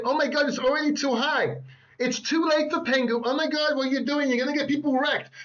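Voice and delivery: mocking whiny doubters. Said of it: "People saying that Pengu".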